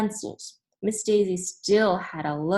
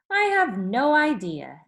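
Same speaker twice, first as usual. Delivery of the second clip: teacher voice; 5 semitones higher, 5 dB louder than usual. Acted.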